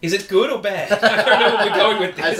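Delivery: talking through laughter; confused and cracking up mid-question; laughing confusion. Real, not acted. Host lost in a friend's album bit, laughing.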